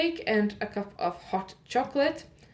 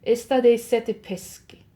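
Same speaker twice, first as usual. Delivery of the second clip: Italian accent, a little louder; 2 semitones higher, 6 dB louder than usual. Shown as a demonstration. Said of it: "okay with doing the Italian accent".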